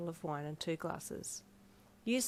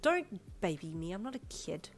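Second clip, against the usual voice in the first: petulant pout, stretched opening words. A narrator's reading. Refusing to be babied, pouting. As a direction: protest like a sulky kid. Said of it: "you pouted harder".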